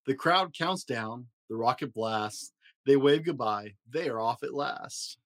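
gentle bedtime story reading; reading to children. A narrator's reading. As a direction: read it like a dad at bedtime, sing-song rhymes. Reading a picture book aloud.

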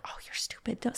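whispered scolding to herself; self-scolding whisper. Retold or imitated. Archer whispering to herself mid-round, scolding. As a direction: hiss at yourself to stop crying. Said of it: "and I'm like".

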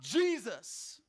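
acted call for Jesus; brief emphatic call, falling away; yelling out. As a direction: cry the name out. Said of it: "but he's yelling out".

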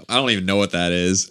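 ordinary talk, nothing marked

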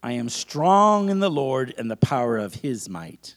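slow, loud preacher's affirmation; emphatic, stretched-out call for repetition; leading a declaration. Acted. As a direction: proclaim it phrase by phrase for the congregation to echo. Leads a congregation in declarations.

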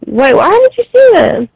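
ordinary talk, nothing marked

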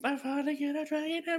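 tiny, high, soft squeal; overcome with joy. Pretend. Gamer finally getting his dragon emblem, overcome squeal. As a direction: squeak it out, hammed-up and overwhelmed.

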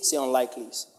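usual speaking voice

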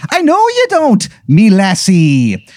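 very high stage-Irish leprechaun; horrendous Irish accent. Acted. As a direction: coo it in a squeaky fake Irish brogue. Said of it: "a horrendous accent".

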